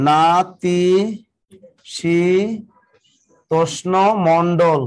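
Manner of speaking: "নাতিশীতোষ্ণ মণ্ডল" drawn out slowly with pauses between syllables. Shown as a demonstration. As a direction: break "নাতিশীতোষ্ণ" into slow, separate syllables, then say "Mondal". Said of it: "এই শব্দটিতে কয়টি অক্ষর আছে". ("নাতিশীতোষ্ণ মণ্ডল" ধীরে, অক্ষরে অক্ষরে ভেঙে বলা)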